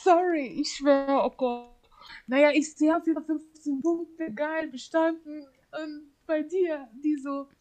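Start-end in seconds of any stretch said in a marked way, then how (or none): none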